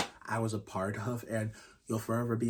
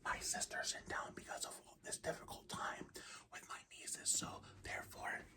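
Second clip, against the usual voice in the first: hushed, unvoiced-sounding whisper to keep from being overheard; real whisper; 18 dB quieter than usual. Real, not acted. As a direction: whisper it very softly, as if someone is in the house. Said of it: "I don't have to whisper".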